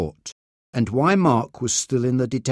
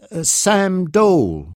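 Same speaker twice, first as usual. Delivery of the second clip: nervously; 6 semitones higher, 3 dB louder than usual. A narrator's reading. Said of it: "said the man nervously".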